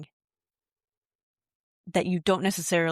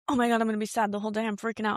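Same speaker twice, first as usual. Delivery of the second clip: mock panic; 3 semitones higher, much faster than usual. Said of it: "Instead of being like".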